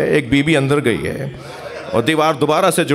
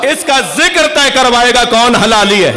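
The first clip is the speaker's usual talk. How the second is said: much higher and louder, ringing sermon climax; preaching at full force; 8 semitones higher, 5 dB louder than usual. Acted. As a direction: proclaim it to the whole hall. Climax of the Prophet's ruling. (بلند آواز میں جوشیلی خطابت)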